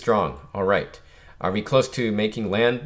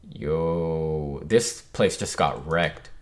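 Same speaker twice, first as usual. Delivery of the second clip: slow, low gruff drawl; low tough guy; 6 semitones lower, much slower than usual. Acted. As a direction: lower your voice, unimpressed. Character line, low slow.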